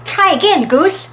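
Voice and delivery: high, loud, merry call; mock laughing. A narrator's reading. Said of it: "laughed Hare".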